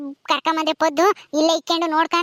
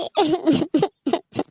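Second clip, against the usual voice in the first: short bout of stage sobs; acted sobbing; 3 semitones lower than usual. Daughter cannot bear father's suffering, sobbing. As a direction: break down sobbing after the plea. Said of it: "ಅಳ್ಬೇಡ, ಸುಮ್ನಿರಮ್ಮ".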